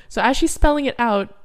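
usual speaking voice

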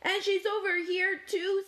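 high, urgent call; high-pitched storybook announcement; chiming in. A narrator's reading. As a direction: add yet another find. Cat calls out third piece.

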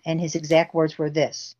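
usual speaking voice

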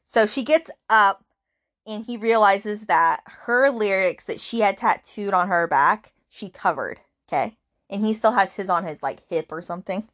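normal talking voice

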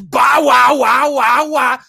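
loud barking bow wow, shouted; mocking dog bark. Commentator mocking a slogan as dog barking. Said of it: "That translates to me as".

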